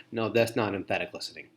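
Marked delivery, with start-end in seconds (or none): none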